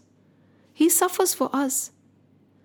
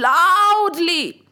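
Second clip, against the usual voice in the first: "loudly" stretched long and high; long, swooping "loudly"; emphatic drawl; 5 semitones higher, 3 dB louder than usual. A narrator's reading. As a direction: draw out LOUDLY with a swell. Drawn out to mimic loud crying.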